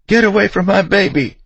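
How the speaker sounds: high, loud maternal shriek; frantic, piercing mother's scream. A narrator's reading. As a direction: shriek it at the top of your lungs. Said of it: "shrieking it at the top of her lungs".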